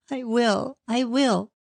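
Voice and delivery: high, pleading through mild sobs; a touch of sobbing, promising. A narrator's reading. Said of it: "sobbed the girl".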